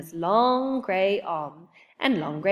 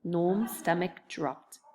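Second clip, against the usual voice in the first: plain reading voice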